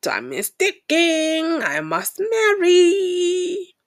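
high, drawn-out whiny mock lament; slow, high sing-song whine of someone rushing to marry; mock desperate wail. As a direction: wail it like a panicking bride-to-be. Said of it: "they say".

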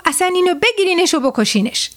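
mildly angry master ordering servants; understated storybook anger, starting high. A narrator's reading. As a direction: give the order with a touch of temper. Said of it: "ارباب با عصبانیت دستور داد".

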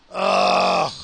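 a very low, rumbling ugh; deep disgusted groan. Real, not acted. Grossed out by modern eaters, deep groan.

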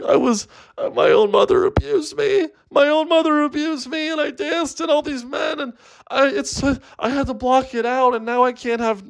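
high, wailing sob story; hammed-up tearful blubbering at a very high pitch; mock crying. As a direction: wail it like a girl sobbing in therapy. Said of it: "she's, like, crying".

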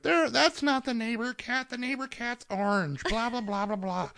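high grandma voice, softening as it goes; imitated grandma chattering high, fading off. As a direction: put on a high, thin-volume grandma voice. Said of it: "grandma's like".

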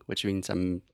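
ordinary delivery

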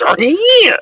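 very high, loud chimp shriek; chimpanzee screech. Retold or imitated. Player imitating his friend's character storming in again, chimpanzee screech. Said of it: "again, like a fucking chimpanzee".

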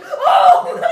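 high loud startled oh; surprised gasp. Retold or imitated. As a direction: jump with fake shock. Crowd gasps at invisible man.

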